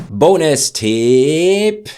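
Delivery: loud, slow, drawn-out announcement; announcing. Real, not acted. Teacher announcing the bonus tip, loud and stretched. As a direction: call it out like a show host.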